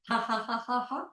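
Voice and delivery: deliberate high ha-ha laugh; laughing sound. Shown as a demonstration. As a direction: laugh on purpose in even beats. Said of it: "with a laughing sound".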